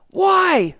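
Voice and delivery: high, loud call to a crowd; retold crowd-leader shout. Host leads kids in a spelling chant, retold shout. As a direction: shout it like leading a kids' chant. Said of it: "say it back loud".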